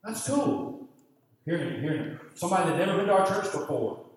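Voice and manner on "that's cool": pleased thought. Retold or imitated. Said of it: "I thought".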